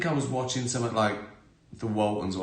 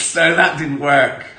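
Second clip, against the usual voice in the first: laughing; 5 semitones higher, 12 dB louder than usual. Real, not acted. Laughing at own story.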